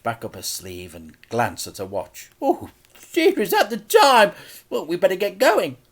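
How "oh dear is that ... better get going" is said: put-on much higher, louder old-lady voice; a fussy auntie greeting; Auntie Flip, cheery. A narrator's reading. Auntie Flip's voice.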